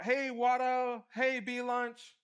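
long, high call-out, drawn out slowly; yelling out. Acted. Yelling nicknames to a friend who doesn't hear, calling out. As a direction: holler a nickname to get someone's attention. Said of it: "you might yell out".

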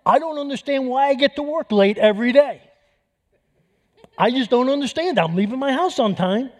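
a pleading guy who doesn't get why he's late; sincere late employee. Retold or imitated. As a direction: play the baffled worker who swears he left on time. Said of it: "here's what he sincerely said".

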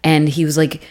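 normal talking voice

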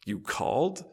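soft, sleepy mumble; dribbled out. A narrator's reading. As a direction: let it dribble out half-awake. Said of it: "he dribbles out".

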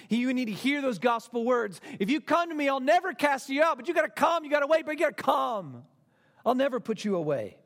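preaching at full force. Acted. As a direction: proclaim the promise with full voice.

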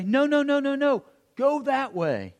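high-pitched calling across a field; urgent coach call. Acted. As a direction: call out like a coach redirecting a kid. Acted coach redirecting a player.

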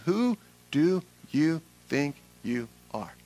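word by word, with gaps between; slow, measured challenge. Acted. Same refrain, slowed to close list.

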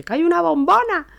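very high, loud alarm; panicked shriek. Retold or imitated. Warning her father about the gas bottle, very high panicked shout. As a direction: scream the danger out.

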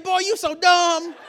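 high, loud, drawn-out mock ridicule; laughing father. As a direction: imitate a dad cracking up at his son. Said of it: "He started laughing".

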